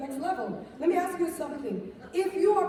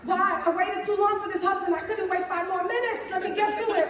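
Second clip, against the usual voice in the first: ordinary delivery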